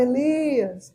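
voiced Lord, hushed call; soft voice. The Lord calling Elias on a breeze, soft. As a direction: call the name softly, drawn out. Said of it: "a voz do Senhor suave".

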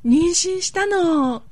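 gasp, then a slow, high shocked line. Acted. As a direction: act out the shocking news. Gasped pregnancy announcement.

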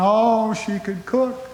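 high, hammed-up tearful wail; mock sobbing. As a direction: wail her praises like a comically heartbroken old man. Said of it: "on a street corner sobbing".

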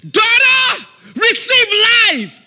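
slow, loud, high authoritative command; shouted declaration of healing; commanding prayer. Shown as a demonstration. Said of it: "You say".

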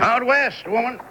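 high, insistent answer to his wife; emphatic retort. Acted. Husband snapping at wife.

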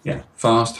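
normal talking voice

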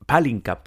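palinka with the first A stressed and a falling close. Shown as a demonstration. Vlogger correcting her palinka, stress on the first A. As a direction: say palinka with the weight on PA-linka. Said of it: "porque el acento lo lleva en la A".

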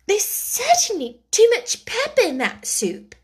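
put-on high young girl, to herself; light girlish aside. A narrator's reading. Alice voice in story.

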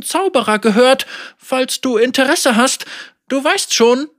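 hohe, zögernde Bittstellerin; unterwürfige Anführerin. Acted. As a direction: vorsichtig schmeicheln. Margot speaks. (zögerlich unterwürfig)

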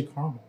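"caramel" as CAR-mul; two-syllable caramel. Shown as a demonstration. Asking friends which they say, "caramel" as CAR-mul. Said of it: "or do you say caramel".

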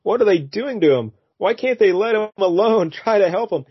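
high, pleading mock heroine voice; hammed-up high anguished pleading; distressed heroine. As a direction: plead like a soap-opera damsel. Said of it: "Janice is like".

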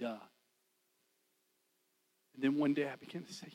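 normal talking voice

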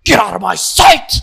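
very loud furious yell; backstage roar. Retold or imitated. Host roaring at staff backstage, hammed-up rage. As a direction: bellow it at the staff. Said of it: "she gets in the back".

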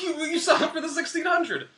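high-pitched, exasperated reminder; insistent coach. Retold or imitated. Coach reminds athlete of sixteen hundred, insistent.

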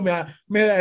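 ordinary talk, nothing marked

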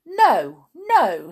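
loud, high refusal; objecting queen. A narrator's reading. Queen overruling the king before the jury, loud objection. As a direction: overrule him loudly. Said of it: "said the queen".

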